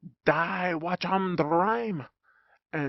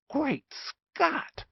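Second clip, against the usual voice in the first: much higher startled exclamation; exclaimed; 12 semitones higher than usual. Acted. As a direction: blurt it out in shock. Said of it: "exclaimed Doctor Glueface".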